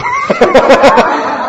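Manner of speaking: a very high burst of laughter. Real, not acted. Preacher laughs at his own impression, high and loud.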